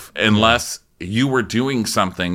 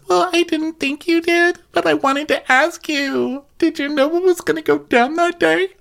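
much higher, innocent little voice; high sweet voice. Retold or imitated. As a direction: play the innocent baby. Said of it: "And Jen says".

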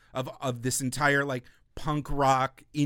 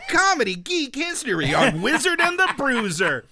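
hyped show intro; 8 semitones higher, 6 dB louder than usual. Pretend. Podcast intro announcement.

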